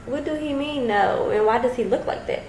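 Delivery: puzzled questioning, starting high; mildly baffled, pitch lifting on the questions; retold confusion. Woman puzzling over a stranger's no, retold bewilderment. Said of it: "I was confused at first".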